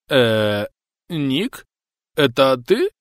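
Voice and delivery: put-on rising, drunken disbelief; surprised drunk. A narrator's reading. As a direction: squint and recognize, amazed. Drunk recognizes old friend.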